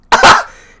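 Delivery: loud wheezing laugh; loud unvoiced cackle; loud burst of laughter. Real, not acted. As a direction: explode into laughter. Reacting to the video.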